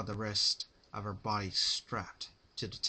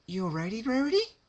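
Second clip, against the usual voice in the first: high sing-song question rising very high; mock-concerned Pinkie; 13 semitones higher, 7 dB louder, faster than usual. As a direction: ask sweetly, too sweetly. Pinkie asking sweetly.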